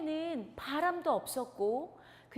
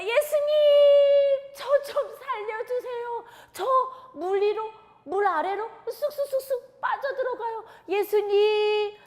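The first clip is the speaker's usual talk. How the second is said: much higher, louder pleading; desperate cry for help; 9 semitones higher, 9 dB louder than usual. Acted. Peter sinking, crying to Jesus for rescue. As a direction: call out like someone sinking in water.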